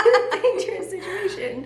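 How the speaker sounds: words breaking up into laughter; real laughing. Real, not acted. Friends mocking their own risky choices, laughing hard.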